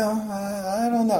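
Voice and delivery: soft, mock-whimpering reply. Pharisees cornered by Jesus, softly mock-whimpering. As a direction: whimper it like a caught coward. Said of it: "standing up there whimpering".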